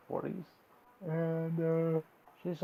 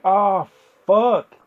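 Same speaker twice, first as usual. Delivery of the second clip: very loud, high mock panic; big loud outburst of dismay; acted alarm; 7 semitones higher, 18 dB louder than usual. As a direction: cry it out in hammed-up dread. Wife realizes husband is a threat.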